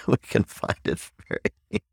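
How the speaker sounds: amused, words breaking into laughter; laughing while talking. Real, not acted. Speaker amused by attempts to offend us, laughing. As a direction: let the laugh swallow the words.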